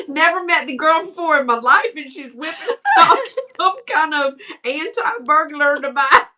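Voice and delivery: usual speaking voice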